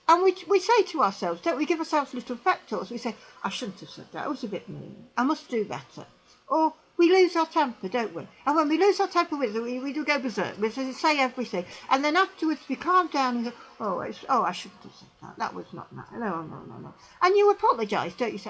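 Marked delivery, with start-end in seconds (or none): 3.4-6.1 soft, lower, sheepish murmur; quiet guilty sigh of words; rueful self-reproach. Retold or imitated. Inner pep talk. 13.8-16.9 low, near-whispered self-reproach; ashamed hush; hushed remorse. Retold or imitated. Regret after losing temper.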